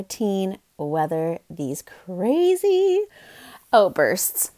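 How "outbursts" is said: normal talking voice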